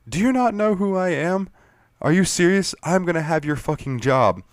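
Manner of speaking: high, mock outraged entitled voice; mock indignant. Player to guard, mock outrage at being fined. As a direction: act like an offended big shot. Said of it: "And I was like".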